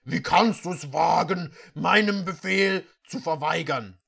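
angry prince. Acted. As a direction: rage at the disobedient servant. Prince rages at servant.

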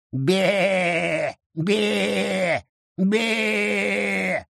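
mock the goat; bleating mockery. A narrator's reading. Mocking the goat.

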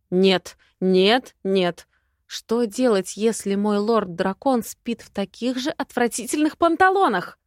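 plain reading voice

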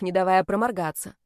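ordinary delivery, nothing marked

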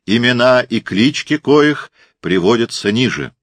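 loud, high public proclamation; town crier. A narrator's reading. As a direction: read the decree out to the crowd. Crier reads decree.

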